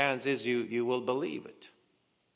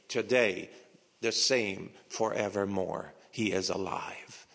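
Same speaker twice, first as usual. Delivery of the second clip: ordinary delivery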